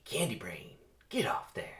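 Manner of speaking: hissed urgent whisper; whispered scolding; whispered warning. Acted. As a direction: warn him under the breath. Jackson warns Barry.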